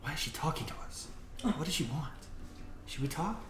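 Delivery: soft, fast whispering voices; creatures whispering. Acted. GM voicing creatures in the trees, whispering fast. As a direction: hush it like nervous creatures. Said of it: "you hear again, like".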